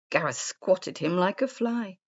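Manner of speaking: ordinary delivery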